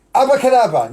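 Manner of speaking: high, loud abracadabra; magic word shout. Acted. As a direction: call the spell out big.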